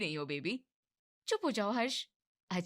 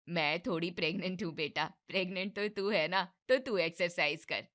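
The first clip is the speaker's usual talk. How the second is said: ordinary talk, nothing marked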